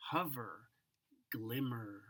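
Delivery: plain reading voice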